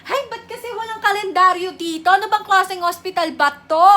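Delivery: loud, high, rapid grumbling; exasperated outburst. Acted. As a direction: complain loudly at the useless hospital. Loses track of date, complains. (pasigaw na pagkainis)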